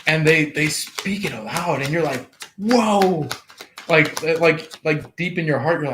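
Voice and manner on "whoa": louder, higher amazed whoa. Pretend. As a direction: react as if struck by it. Reacting to words spoken aloud.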